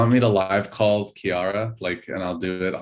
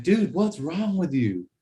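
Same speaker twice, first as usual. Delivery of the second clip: retold yell; 8 semitones higher, slower than usual. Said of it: "yell at Max".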